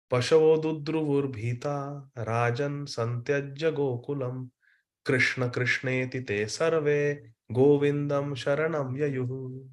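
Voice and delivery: slow, measured Sanskrit chant; slow scripture recitation; verse recitation. Shown as a demonstration. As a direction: recite the verse slowly and reverently.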